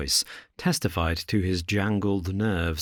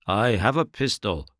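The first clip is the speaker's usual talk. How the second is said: a hint of frightened quaver; lightly voiced nervous man with a pistol; reedy quivering voice. A narrator's reading. Frightened man warning intruders on the dark stair, mild quaver. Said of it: "a reedy, quivering voice".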